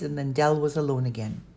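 ordinary delivery

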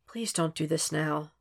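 soft, sighed plea; sighing. A narrator's reading. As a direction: plead quietly on a sigh. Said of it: "Will sighed".

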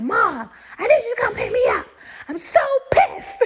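high-pitched upset wail to mom; upset daughter. Retold or imitated. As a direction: whine it up high, hammed-up distress. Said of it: "So now I'm upset".

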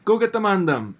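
playful put-on London slang; London roadman accent. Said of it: "drop a little of those Top Boy expressions".

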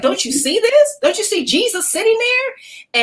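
high-pitched, incredulous plea; astonished urging. Retold or imitated. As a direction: re-enact urgently making a friend look. Alarmed quote to oblivious friend in dream.

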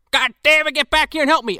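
high, put-on yell for help; mock frustrated call. Stranded character calling the shopkeeper back, mock frustrated. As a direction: call someone back in pretend annoyance.

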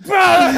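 high, loud burst of noise; imitated scream. Imitating the trainspotter as the train passes, loud scream. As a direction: blast out a high mock scream. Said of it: "fucking screaming".

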